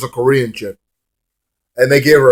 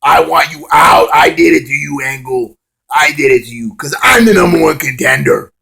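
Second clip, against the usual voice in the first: mock shouted villain threat; hammed-up heel promo; heel callout; 3 semitones higher than usual. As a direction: bark it like a wrestling heel. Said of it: "E Y came out and said".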